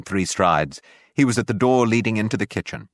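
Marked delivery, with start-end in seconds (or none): none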